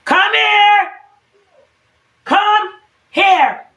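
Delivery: real high-pitched, loud holler. Real, not acted. Mom calls her kids over mid-cooking, yelling. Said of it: "You gotta yell at kids".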